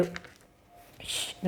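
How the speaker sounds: quick whispered hush; shushing. Acted. Raghavayya hushes Bhujangarao in the wedding crowd, whispered shh. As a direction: hush him so nobody overhears. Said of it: "అతను నెమ్మదిగా చెప్పాడు".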